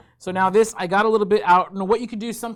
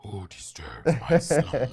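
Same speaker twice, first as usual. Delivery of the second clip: slow whispered voice of a waking statue; grinding stone statue; 5 semitones lower, slower than usual. Pretend. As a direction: rasp it out like something ancient waking up. We had to awaken it from its slumber.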